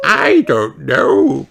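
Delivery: Granny voice. A narrator's reading. Granny answers.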